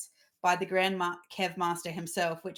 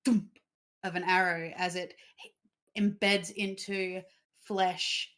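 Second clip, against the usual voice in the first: game master narration; slower than usual. A narrator's reading. Game master describes the scene.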